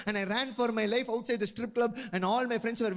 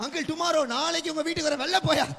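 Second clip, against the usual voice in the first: embarrassed Tamil uncle hissing to a relative, higher and louder; flustered uncle; 8 semitones higher, 4 dB louder, much faster than usual. Retold or imitated. Tamil man at strip club hurrying a relative away, hushed panic.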